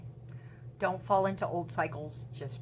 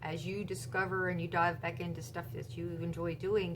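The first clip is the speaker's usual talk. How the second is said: ordinary delivery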